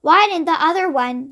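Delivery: ordinary delivery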